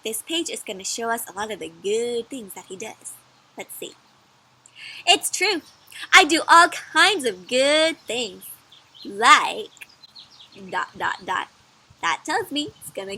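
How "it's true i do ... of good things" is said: bright, loud storybook egg voice; cheerful egg character. Acted. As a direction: play the proud little egg.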